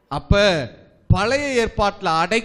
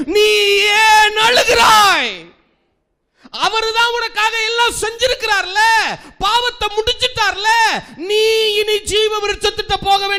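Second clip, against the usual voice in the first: building from a high tearful plea to a crying, much higher cry; tearful angel's plea; 8 semitones higher, 3 dB louder than usual. Acted. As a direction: plead with the weeping woman, rising to a tearful shout. Voicing the cherubim to Mary. (அழுகையுடன் உயர்ந்து செல்லும் தூதனின் குரல்)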